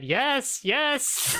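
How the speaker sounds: high, triumphant yeses; exultant cheer. Real, not acted. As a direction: cry out in high-pitched triumph. Mock triumph, hands above head.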